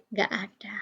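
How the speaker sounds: bisikkan pelan di akhir penjelasan; bisikan pelan. Real, not acted. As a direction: suara nyaris tak bersuara, seperti menutup kalimat. Guru menjelaskan, lalu berbisik.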